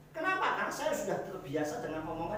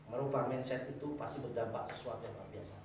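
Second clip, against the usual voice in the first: quiet restatement; 6 semitones lower, 8 dB quieter than usual. Acted. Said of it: "same point repeated, dropped soft".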